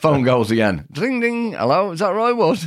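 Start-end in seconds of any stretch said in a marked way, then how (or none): 0.9-1.5 high sing-out phone ring; imitated phone ring. 1.5-2.6 high-pitched phone enquiry; imitated caller. Acted caller ringing a second time.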